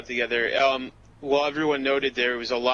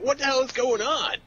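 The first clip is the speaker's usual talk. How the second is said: higher-pitched baffled outcry; imitated confusion; 9 semitones higher than usual. New players find everyone asleep at once, acted confused. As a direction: act out bewildered newcomers. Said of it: "like, confused".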